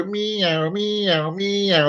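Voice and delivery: high meows swooping like a siren; police siren meow. Acted. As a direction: wail meows like a police car. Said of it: "impression of a police car".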